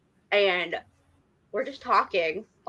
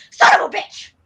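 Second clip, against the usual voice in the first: loud raspy yelled curse, pitched way up; full on Dean Winchester; 7 dB louder than usual. Retold or imitated. Telling the actors a story, shouting like Dean Winchester. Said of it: "Really loud, like, full on Dean Winchester".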